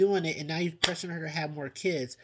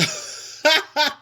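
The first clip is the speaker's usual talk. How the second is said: real laugh; 9 semitones higher, 7 dB louder than usual. Real, not acted. Laughing at Caitlyn's outburst.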